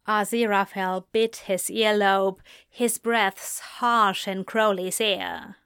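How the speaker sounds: ordinary talk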